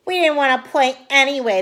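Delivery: loud sulking denial; sulky. A narrator's reading. Boys sulking at Ruby, loud denial. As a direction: sulk it out loud. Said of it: "the boys said".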